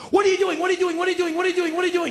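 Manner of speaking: high-pitched rapid-fire demand, over and over; panicked, insistent repetition with no breathing room; frantic questioning. Retold or imitated. Homeowner confronting a burglar, frantic and high.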